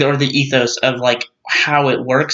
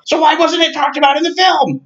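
exasperated outburst; 12 semitones higher than usual. Real, not acted. Pushing back on a friend's defense of the film, exasperated near-shout. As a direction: fire the question like you can't believe it. Exasperated pushback in movie argument.

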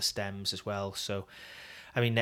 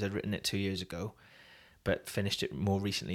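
ordinary talk

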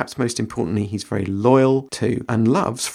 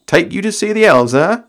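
amused Gandalf; 6 semitones higher, 7 dB louder than usual. Acted. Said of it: "he said, with a smile".

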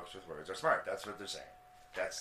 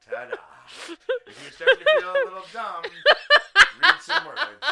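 high, loud words through laughter; cracking up mid-sentence; laughing; 18 dB louder than usual. Real, not acted. Laughing through a mock verdict.

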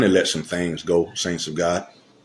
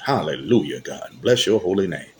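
ordinary talk

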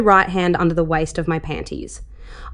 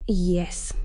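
barely there breathy whisper, no voice; 6 dB quieter than usual. A narrator's reading. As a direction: hush the single word right into her ear. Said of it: "the last word was whispered so quietly into my ear".

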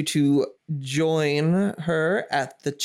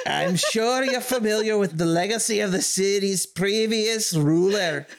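put-on Scottish accent; 5 semitones higher than usual. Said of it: "Queen Cressida has a Scottish accent".

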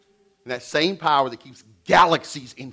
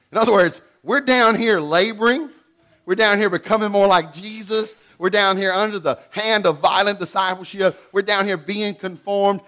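preaching at full force; 6 semitones higher, 5 dB louder than usual. Real, not acted. Pastor preaching hard to the congregation, loud and high. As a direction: drive each "we're down here" home.

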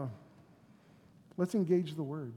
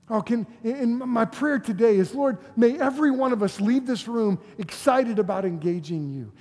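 loud, raised-pitch fervent appeal; impassioned preaching; 6 semitones higher, 9 dB louder than usual. Real, not acted. Preacher praying that everyone leaves engaged, impassioned. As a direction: plead urgently with the whole room.

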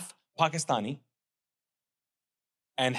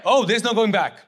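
firm wife; 5 semitones higher, 9 dB louder than usual. Retold or imitated. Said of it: "She's like".

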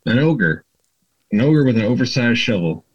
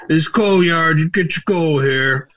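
ogre voice; 5 semitones higher than usual. Acted. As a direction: play the simple ogre, pitched up.

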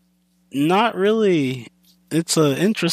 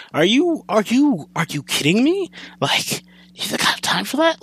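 exasperated disbelief; 7 semitones higher than usual. Real, not acted. Ranting about filler episodes.

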